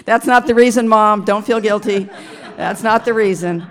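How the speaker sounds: loud laughing reassurance; laughing to mom. Real, not acted. Son laughing loudly to his mom in the audience, reassuring.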